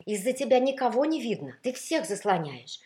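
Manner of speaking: loud, indignant reproach; scolding baritone role. A narrator's reading. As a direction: tell off a selfish rival, pressing hard. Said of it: "не унимался баритон".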